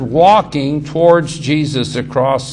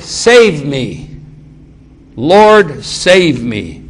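cry for help; 5 dB louder, much slower than usual. Retold or imitated. Peter sinking, cries to Jesus.

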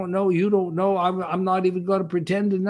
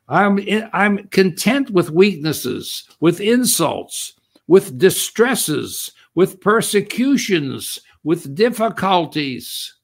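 louder, slow, each phrase hammered; preaching with force; 3 semitones higher, 5 dB louder, slower than usual. Acted. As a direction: build it up like a proclamation.

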